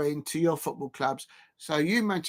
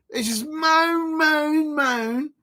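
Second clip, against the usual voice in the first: high, whiny crying voice, much higher, slow; mock whining; 10 semitones higher, much slower than usual. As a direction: mimic a crybaby moaning. Mocking the manager's moaning.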